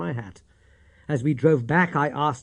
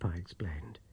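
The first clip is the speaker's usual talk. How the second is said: ordinary delivery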